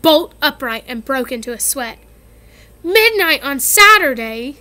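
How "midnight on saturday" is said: hoarse voice. A narrator's reading. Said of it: "he said in a hoarse voice".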